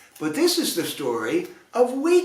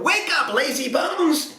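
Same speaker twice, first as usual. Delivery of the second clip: hollered; 11 semitones higher than usual. A narrator's reading. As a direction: holler it to wake her. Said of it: "and hollered".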